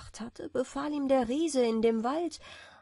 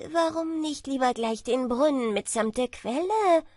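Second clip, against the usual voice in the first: cocky, bragging tailor; boastful; 4 semitones higher, 5 dB louder than usual. A narrator's reading. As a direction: brag with a smug lift. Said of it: "fragte der Prahlhans". (prahlerischer Schneider)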